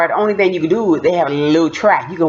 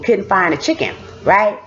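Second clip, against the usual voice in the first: usual speaking voice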